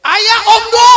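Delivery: high, loud snapping wife; wife snapping at husband's empty promise; mock angry wife. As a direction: scold him like a fierce wife.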